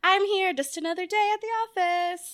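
high, breezy small-talk voice; cheerfully guarded small talk; customer service voice. Retold or imitated. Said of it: "they get the real basic".

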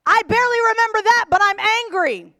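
loud, high exasperated retort; imitating someone irrationally angry, voice leaping up; mock angry outburst. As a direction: shout it back like a stubborn grudge-holder. Second angry reply in same dialogue.

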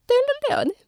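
high, silly put-on voice; mock his casual answer; mocking boyfriend voice. Boyfriend's reply retold.

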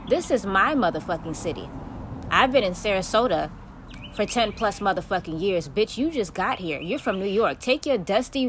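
usual speaking voice

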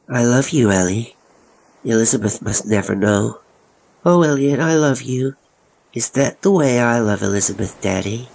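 high, mimicking child's voice; high-pitched, eerily detached child; bland little boy. A narrator's reading. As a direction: parrot overheard endearments like a small boy. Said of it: "Tommy's voice was bland".

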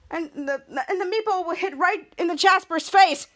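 usual speaking voice

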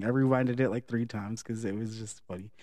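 ordinary talk, nothing marked

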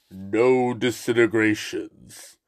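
low, slow Vader voice; a hint of a menacing Darth Vader, slow and low; Vader impression. Quoting Vader to Boba Fett.

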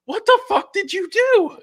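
high, shrieking mock outrage; shocked girlfriend. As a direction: squeal it like a horrified girlfriend. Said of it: "And she goes".

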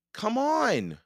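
high-pitched, pressing encouragement; urgent urging. A narrator's reading. Jack coaxing Annie out of mud, urgent.

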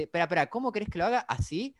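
fast, mocking retort to the director; quick imitation of a snide actor; mocking Shatner rant. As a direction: needle the director, quick and sneering. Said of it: "le dice".

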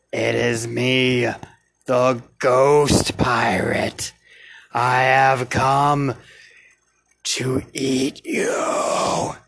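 slow eerie ghost pirate; spooky ghost voice. A narrator's reading. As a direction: draw it out like a menacing ghost.

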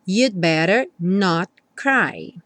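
slow, "not" closed by a silent T; held T in not. Shown as a demonstration. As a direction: stop the tongue on the T of "not". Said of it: "mais comum falar not cry". (T de "not" só fechando o som)

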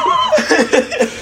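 squealing laugh from the spice; high-pitched pained laugh. Real, not acted. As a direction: shriek of laughter through the burn. Pained laugh at the hot wings.

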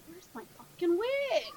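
high, slow, drawn-out exasperated whine; retold exasperation. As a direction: act out a frustrated search, stretching the words. Acts out hunting for lost wig.

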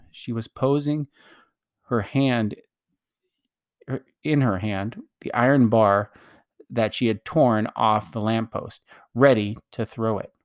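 plain reading voice

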